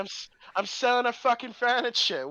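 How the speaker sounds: hammed-up vulgar Cockney character; Cockney accent. Dream Watson challenging someone, in mock Cockney. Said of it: "is that Cockney?".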